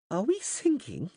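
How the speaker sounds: high, slow frightened whimper. A narrator's reading. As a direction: ask it close to tears. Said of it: "he said, whimpering".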